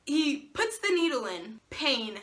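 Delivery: usual speaking voice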